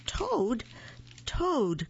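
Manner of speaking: high, falling call; crying out. A narrator's reading. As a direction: call the name to wake someone. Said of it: "cried Frog".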